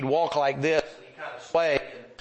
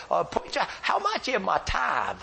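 Herbie; 6 semitones higher than usual. Retold or imitated. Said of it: "He'd say".

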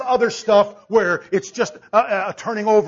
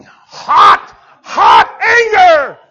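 loud, high, drawn-out mock shout; hammed-up anger; 12 semitones higher, 14 dB louder, much slower than usual. Husband describing wife's glowing anger, hammed-up angry shout. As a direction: blast it out in exaggerated fury.

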